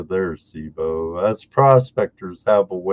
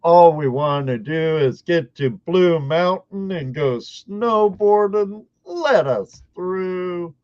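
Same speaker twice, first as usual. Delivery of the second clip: exasperated plea; 9 semitones higher, 3 dB louder than usual. Retold or imitated. Frustration retold at border.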